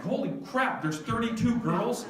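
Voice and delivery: high-pitched incredulous question; mock-panicked, voice shooting up at the end; shocked disbelief. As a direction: repeat the news back in alarm. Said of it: "I'm like".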